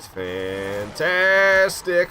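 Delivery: long, high, drawn-out sarcastic fantastic. Real, not acted. Gamer mocking his own luck, stretched sarcastic fantastic. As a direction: stretch the word out in mock delight.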